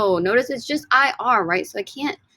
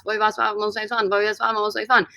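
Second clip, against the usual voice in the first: quick tongue-twister recitation; rapid-fire drill; faster than usual. Shown as a demonstration. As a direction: race through the verb forms, words run together. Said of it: "I say it twenty times fast".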